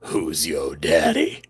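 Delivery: very low, slow daddy voice; mock-seductive deep voice. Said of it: "Do you want me to do it again?".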